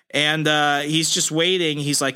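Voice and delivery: normal talking voice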